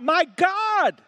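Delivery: a high, loud anguished cry. Retold or imitated. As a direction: cry out to heaven in agony. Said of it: "Jesus cries out".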